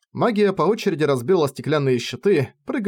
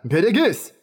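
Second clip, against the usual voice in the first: warning shout; 5 semitones higher than usual. Acted. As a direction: warn her of the blow. (предостерегающий крик)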